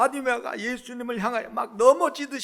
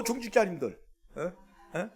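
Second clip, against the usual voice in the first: ordinary talk, nothing marked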